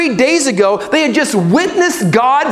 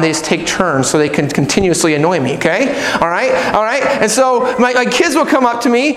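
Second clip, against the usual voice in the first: normal talking voice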